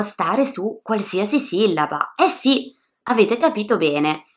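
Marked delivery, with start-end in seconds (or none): none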